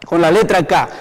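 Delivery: ordinary talk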